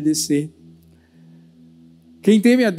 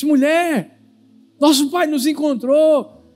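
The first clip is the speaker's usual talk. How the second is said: higher, insistent acted voice; husband reasoning with wife; 7 semitones higher, 3 dB louder than usual. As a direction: plead with a crying wife, lightly hammed up.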